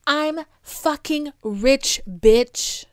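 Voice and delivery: slow, drawn-out mock yell; mock scream. Elon grabbing the mic at the crowd, slow defiant mock yell. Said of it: "And I scream".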